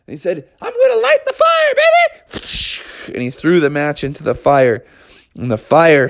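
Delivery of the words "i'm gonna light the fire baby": put-on gleeful kid bear. Junior crows about lighting fire.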